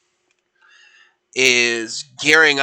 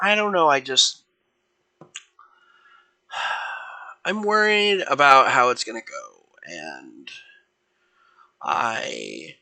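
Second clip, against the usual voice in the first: acted worry with sighs and long pauses; nervous; 3 dB quieter than usual. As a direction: hesitate and sigh like a worried athlete. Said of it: "looks kind of nervous".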